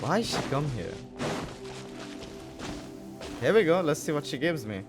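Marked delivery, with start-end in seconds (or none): none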